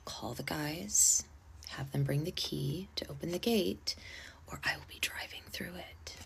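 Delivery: retold threat, dropping almost to a whisper; quiet threat. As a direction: say it hushed, patience used up. Exhausted patience, quiet threat to bride.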